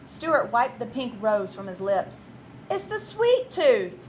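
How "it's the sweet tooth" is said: high, slow whiny excuse; whining boy. Acted. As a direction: plead it like a kid. Stuart blames the tooth.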